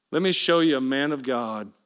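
ordinary delivery

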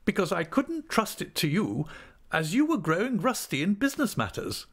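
high-pitched, brisk aunt's voice; talkative aunt. Acted. Aunt briskly tells of managing her own money, high-pitched. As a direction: speak up high as a forthright older relative.

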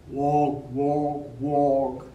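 low loud croaking frog. Acted. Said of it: "sang the frog".

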